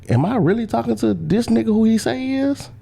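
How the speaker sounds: high-pitched disbelief, as if thinking aloud; can-you-believe-this inner voice; incredulous. Retold or imitated. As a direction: question it in a rising, incredulous voice. Acted disbelieving thought to himself.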